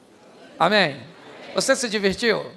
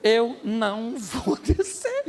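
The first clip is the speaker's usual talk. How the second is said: slow drawn-out refusal; much slower than usual. Retold or imitated.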